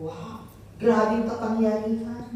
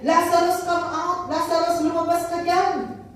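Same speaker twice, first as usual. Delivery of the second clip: raised calling voice, higher; loud call; 6 semitones higher than usual. Acted. Jesus calls Lazarus out of the cave, raised call. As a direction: call the dead man by name. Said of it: "Jesus called in a loud voice".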